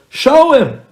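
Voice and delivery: a high, loud, demanding push; loud insistence. Retold or imitated. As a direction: demand it, shouting over him. Buddy insisting again.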